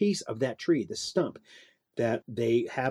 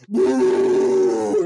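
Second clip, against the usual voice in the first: loud jeering boo; mock boo; 7 dB louder than usual. Audience member booing the puppet, loud jeer. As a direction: boo from the crowd.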